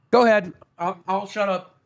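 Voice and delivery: ordinary talk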